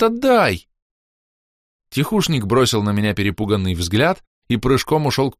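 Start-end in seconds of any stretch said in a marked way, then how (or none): 0.0-0.7 high excited отдай; bright girlish отдай, thrilled; eager demand. A narrator's reading. First demand.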